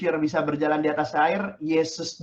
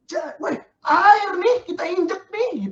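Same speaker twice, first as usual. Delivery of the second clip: much higher, louder, near-crying squeal; amazed squeal; 13 semitones higher, 4 dB louder, slower than usual. Pretend. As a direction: squeal in disbelief at walking on water. Peter amazed stepping on water.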